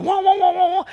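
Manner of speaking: high, loud organ wahs; imitated church organ. Preacher showing how the organ was going, imitated organ wails. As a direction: mimic a pumping organ on wah. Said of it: "the organ was going".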